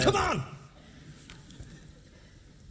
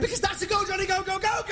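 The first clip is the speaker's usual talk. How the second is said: much higher, gleeful go go chant; excited insisting; 9 semitones higher, faster than usual. Acted. Teasing Doc about the rules, high and gleeful. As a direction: crow the rule at him.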